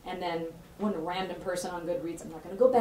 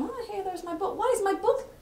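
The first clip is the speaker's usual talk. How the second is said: surprised squeal; 12 semitones higher than usual. Retold or imitated. Author spotting her book on a slideshow, surprised squeal. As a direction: gasp of finding her own book up there.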